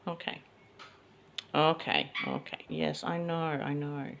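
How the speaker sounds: ordinary talk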